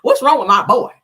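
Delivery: hollering country mama, pitched high; loud mock outrage of a protective mother; Marlene impression. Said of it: "that's how Marlene talk".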